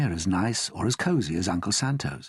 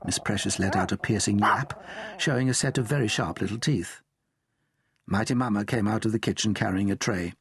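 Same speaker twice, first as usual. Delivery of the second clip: ordinary delivery, nothing marked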